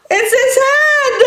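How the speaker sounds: high, wailing fright; hammed-up cartoon horror; horrified cry. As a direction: kid mistakes a potato for Max's head. Thinks potato is Max.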